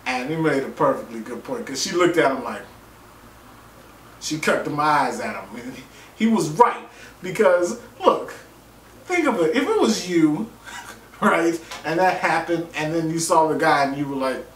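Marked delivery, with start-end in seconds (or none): none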